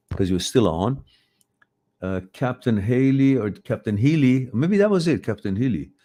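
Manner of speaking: ordinary delivery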